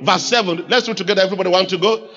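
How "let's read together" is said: normal talking voice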